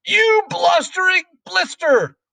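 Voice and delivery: high, loud, drawn-out insult; enraged Trunchbull at full pitch; furious yell. A narrator's reading. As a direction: yell the abuse slowly and shrilly at the boy. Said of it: "yelled the Trunchbull".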